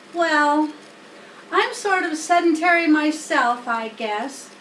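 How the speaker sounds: a lightly voiced humble pig, sheepish; Wilbur voiced. A narrator's reading. Pig admits to the spider he showed off, lightly voiced. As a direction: admit it meekly, a hint of a young pig.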